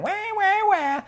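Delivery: mocking whine. Storyteller mimics the complaining women for children, mocking whine. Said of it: "those whining older women".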